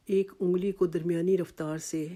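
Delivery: ordinary delivery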